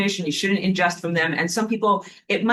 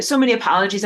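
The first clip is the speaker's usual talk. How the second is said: ordinary delivery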